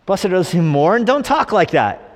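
high-pitched mock disbelief; appalled consultant. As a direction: echo the verse back aghast, then scold. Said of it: "Church growth expert, heart attack".

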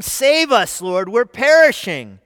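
desperate shout for rescue. Retold or imitated. Disciples yelling at Jesus in the storm, loud panicked shout. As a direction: cry out loud as if sinking. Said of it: "Matthew says they're shouting".

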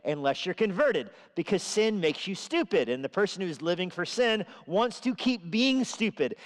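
normal talking voice

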